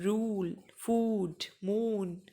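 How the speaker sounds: रूल, फूड, मून with a long oo; drawn-out examples रूल, फूड, मून; long 'oo' demo. Shown as a demonstration. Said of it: "जहाँ पे बड़ा ऊ का sound आ रहा है".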